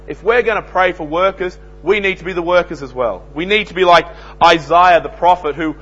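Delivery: loud, high preaching, slowing on key words; fiery sermon delivery; full-force preaching. Acted. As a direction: thunder it out from the pulpit. Preacher builds with loud exhortation.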